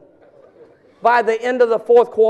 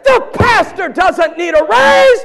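higher, louder and slow joking shout; mock loud pronouncement; 8 semitones higher, 6 dB louder than usual. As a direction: announce it across the church. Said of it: "I said".

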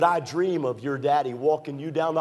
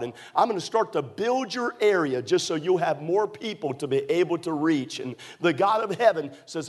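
preaching at full force; 5 semitones higher than usual. Acted. Preacher promising a struggling bus captain, full-force preaching. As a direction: build the sermon up and up.

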